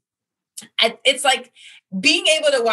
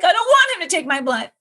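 mock whiny protest; 9 semitones higher than usual. Patient telling staff she refuses that blood-taker, in a mock whine. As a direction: play the fed-up patient begging off the rough guy. Complaining about rough blood draw.

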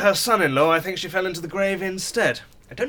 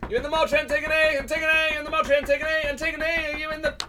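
calling out; 10 semitones higher than usual. Acted. Calling for sister repeatedly.

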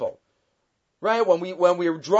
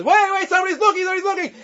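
urgent alarm; 10 semitones higher, much faster than usual. Retold or imitated. Rabbi urgently warns again.

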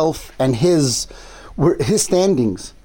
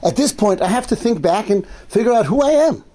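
pitched-up voice of someone taking stock; urgent self-questioning; 7 semitones higher than usual. Retold or imitated. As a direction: imitate a sage suddenly questioning himself, higher. Voicing the sage questioning his life.

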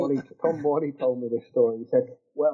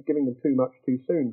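ordinary delivery

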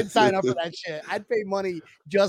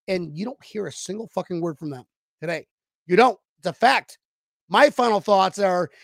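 ordinary talk, nothing marked

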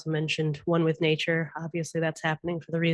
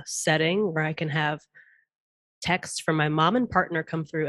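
usual speaking voice

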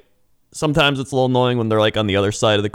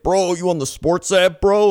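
bro voice; 10 semitones higher, 3 dB louder than usual. Retold or imitated. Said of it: "they're just like".

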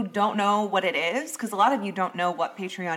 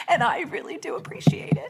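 mock teary; 8 semitones higher than usual. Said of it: "I wondered if you were gonna start crying".

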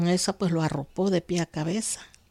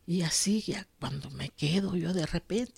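hushed whisper as she remembers; 6 dB quieter than usual. Real, not acted. Telling the interviewer about the rescue, whispering. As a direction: breathe it out in a whisper. Spotting something on the horizon.

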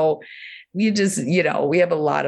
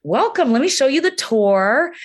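cartoon vulva character; 9 semitones higher, slower than usual. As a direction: play a cheery animated tour guide. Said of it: "she's like".

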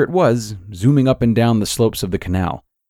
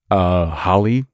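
stammering; slower than usual. A narrator's reading. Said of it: "he stammered".

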